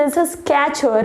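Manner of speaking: Skechers as 'ske-cher', no first r; correct pronunciation. Shown as a demonstration. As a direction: model Skechers with a clean 'sk'. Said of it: "Repeat after me". (Skechersનો સાચો ઉચ્ચાર, 'સ્કેચર')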